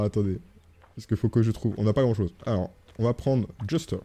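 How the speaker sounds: ordinary delivery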